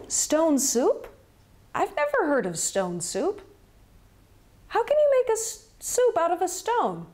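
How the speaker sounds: puzzled villager. Acted. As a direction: sound baffled by the idea. Doubting villager voiced.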